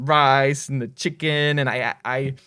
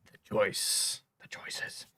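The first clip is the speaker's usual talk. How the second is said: whisper; 12 dB quieter, much slower than usual. Real, not acted. Mulling over chat's choice.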